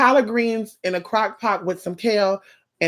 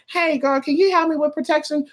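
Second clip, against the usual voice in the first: higher-pitched client asking a favor; 8 semitones higher than usual. Acted. Client to a second reader about protection, high-pitched asking. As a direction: pitch it up like a chummy request. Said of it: "you say".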